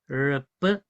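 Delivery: ordinary delivery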